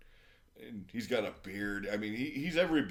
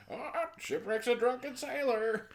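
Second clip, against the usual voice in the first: high, slow parrot squawk; drawn-out high parrot croak; Polly; 13 semitones higher than usual. Acted. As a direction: taunt in a cartoon bird voice. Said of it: "thing that Polly would say".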